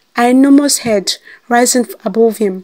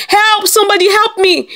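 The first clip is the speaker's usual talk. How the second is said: screaming for help; 10 semitones higher than usual. A narrator's reading. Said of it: "His voice cracked as he screamed".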